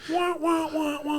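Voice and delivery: high, loud sad-trombone wah wah. Acted. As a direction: sing a mocking sad trombone. Mocking the buzzkill.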